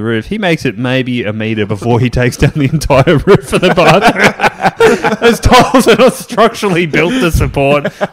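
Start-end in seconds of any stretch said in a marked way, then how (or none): 3.2-8.1 high, loud, through laughter; cracking up while telling it; laughing. Real, not acted. Telling friends about the roof collapse, laughing hard.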